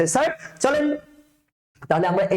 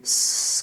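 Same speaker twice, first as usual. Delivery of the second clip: long sharp "sss" of escaping air; tyre puncture hiss; much slower than usual. Shown as a demonstration. Teacher imitates a punctured tyre for students, hissing air. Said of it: "tyre punch করে দিলে এক ধরনের".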